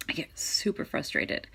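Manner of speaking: soft frustrated whisper. Real, not acted. Confessing frustration.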